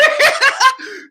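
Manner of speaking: loud high cackle; high laugh. Real, not acted. Laughing at his own joke, high cackle. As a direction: burst out laughing.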